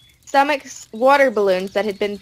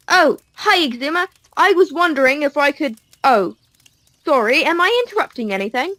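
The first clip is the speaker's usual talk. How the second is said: high cheerful voice; 6 semitones higher, 4 dB louder than usual. Acted. Mumbo greeting at door.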